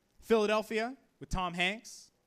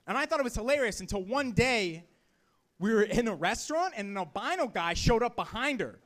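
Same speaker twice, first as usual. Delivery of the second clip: ordinary talk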